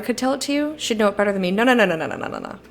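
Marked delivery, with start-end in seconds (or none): 1.5-2.1 a run of quick, louder no, no, no; frantic string of no's; rapid no's. Real, not acted. Refusing the game prompt repeatedly. 2.1-2.6 the same no's, dropped to a whisper; breathless whispered no, no. Real, not acted. The refusal dies to a whisper.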